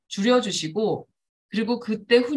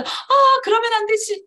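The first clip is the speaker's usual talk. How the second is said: scolding in a very high, loud sing-song; same high tone; 14 semitones higher, 6 dB louder, slower than usual. Shown as a demonstration. Mother scolding in the same high praising tone. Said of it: "이렇게 똑같아요".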